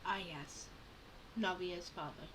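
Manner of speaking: ordinary delivery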